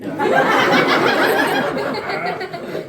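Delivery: loud villainous laugh; evil laugh. Acted. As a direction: cackle at the prisoner. Executioner laughs at victim.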